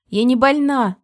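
loud, high denial; shouting. A narrator's reading. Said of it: "крикнула я".

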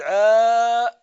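عالم said loud, the long a drawn out; demonstrating pronunciation. Shown as a demonstration. Teacher sounding out عالم loudly for students. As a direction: sound out عالم clearly for the class. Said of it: "تقول".

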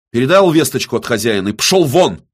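loud, high-pitched dismissal; cracking-voice shout. A narrator's reading. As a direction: order him out at the end of your nerves. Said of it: "срывающимся голосом прокричал Виссарион".

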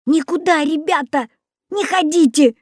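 high, urgent warning; alarmed cry. A narrator's reading. Boy warns friends of Baba Yaga, alarmed cry. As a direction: cry out to stop your friends. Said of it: "закричал я".

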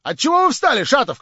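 high, loud challenge; cried out. A narrator's reading. Hostess demands why Shatov stood up, crying out loudly. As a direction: call him out across the room. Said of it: "крикнула хозяйка".

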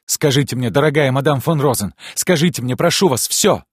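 high, loud, pressing plea; desperate begging. Acted. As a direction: implore with mounting urgency. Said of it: "молил он". (отчаянная мольба)

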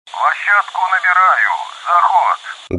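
radio voice. Acted. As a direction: call it in over the radio. Commander radios landing approach.

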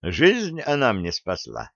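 ordinary delivery, nothing marked

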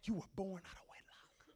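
soft, whispery solemn delivery; hushed preaching. Real, not acted. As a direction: drop to a grave whisper. Preacher drops to a hush.

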